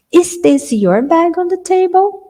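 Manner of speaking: normal talking voice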